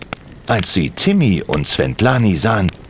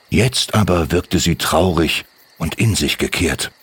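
usual speaking voice

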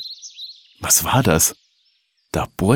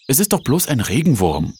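put-on higher-pitched boy's objection; childish protest; 7 semitones higher than usual. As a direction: protest to mother, making light of it. Junge widerspricht der Mutter. (kindlicher, höherer Widerspruch)

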